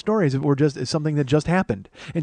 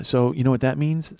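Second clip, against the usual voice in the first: ordinary talk, nothing marked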